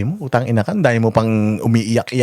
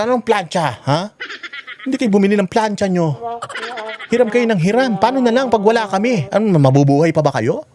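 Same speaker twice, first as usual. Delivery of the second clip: higher, scolding voice of a stingy aunt; 7 semitones higher than usual. Retold or imitated. Imitating a stingy aunt refusing to lend her iron, scolding. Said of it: "ganito yung anti mong madamot".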